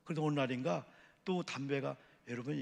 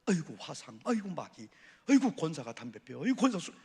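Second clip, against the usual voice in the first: nagging wife; 3 semitones higher, 7 dB louder than usual. Retold or imitated. Wife scolding her smoking husband, mock nagging wail. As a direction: moan and scold like a fed-up wife. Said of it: "그러면 안 돼요".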